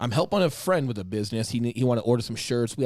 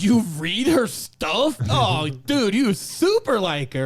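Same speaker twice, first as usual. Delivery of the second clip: slow, higher teasing drawl with a laugh; 9 semitones higher, 5 dB louder, much slower than usual. Retold or imitated. Friend teasing narrator about his crush, slow high teasing. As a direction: tease him slowly, drawing it out.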